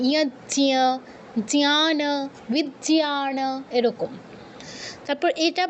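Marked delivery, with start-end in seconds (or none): 0.1-3.4 জ্ঞান as jnyaana, বিজ্ঞান as vijnyaana; j-ny conjunct. Shown as a demonstration. Teacher gives students examples, জ্ঞ spoken as j-ny. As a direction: sound j and ny in ইয়াজ্ঞ, জ্ঞান, বিজ্ঞান. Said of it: "এগুলো উচ্চারণ করে দিলেই হবে".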